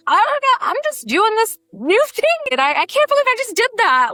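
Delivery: put-on breathless high-pitched baby burlesquer in shock; flustered newbie burlesquer. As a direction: squeal it out like a stunned beginner fresh off stage. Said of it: "you're just like".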